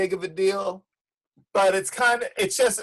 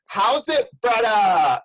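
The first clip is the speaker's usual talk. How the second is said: mock Hawaiian pidgin; 4 dB louder, slower than usual. Mocking mainland dudes back from Hawaii, fake pidgin. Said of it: "Or when dudes go".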